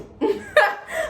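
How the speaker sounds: high laugh; burst of laughter. Real, not acted. Girl laughing at the awkward phone call. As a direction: break out laughing at the memory.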